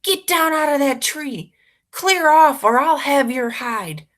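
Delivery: put-on shouted high and loud at a boy in a tree; angry yell. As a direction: bellow him down out of the tree. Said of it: "A man yells".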